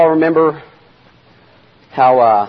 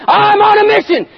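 high, raised announcing voice; determined declaration; 3 dB louder than usual. Acted. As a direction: call it out like someone unstoppable. Voicing Jesus set on his mission.

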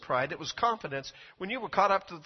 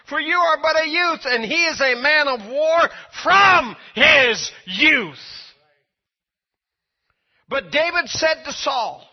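loud, raised-pitch pulpit delivery; full-force preaching; 8 semitones higher, 12 dB louder than usual. Real, not acted. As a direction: drive it home like a fired-up preacher.

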